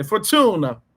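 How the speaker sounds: Fortuna said with a playful Italian accent; "Fortuna" in a lightly Italian style. Shown as a demonstration. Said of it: "say it in an Italian accent".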